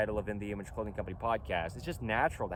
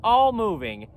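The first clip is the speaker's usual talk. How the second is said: loud emphatic demo; 7 semitones higher, 6 dB louder, much slower than usual. Shown as a demonstration. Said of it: "can I say it like this?".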